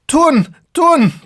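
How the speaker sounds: high, loud delighted cries; mock breathless glee; gleeful repeating. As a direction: call it out breathless with mirth. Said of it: "breathless as they repeat".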